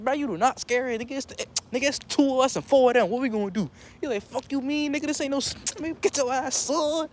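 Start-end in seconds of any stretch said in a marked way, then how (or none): 0.0-3.7 high, urgent retold worry; worried protest. Cousin warns they are outnumbered by clowns, high anxious protest. 4.2-7.1 high-pitched retold bravado; indignant retort. Cousin scolds the worried teller facing clowns, high indignant retort.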